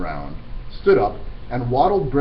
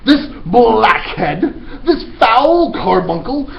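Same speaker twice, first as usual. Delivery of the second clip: ranting headmistress; 11 semitones higher, 7 dB louder than usual. Acted. Trunchbull denouncing the boy to the whole school. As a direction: spit out every insult with disgust.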